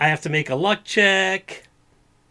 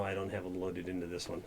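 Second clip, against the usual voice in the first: ordinary delivery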